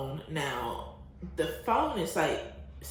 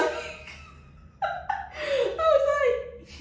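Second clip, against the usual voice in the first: laughing; much slower than usual. Real, not acted. Retelling the driver's question about French, laughing. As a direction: crack up while getting the words out.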